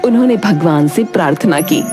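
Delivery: plain reading voice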